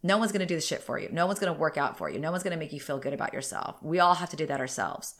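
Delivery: ordinary talk, nothing marked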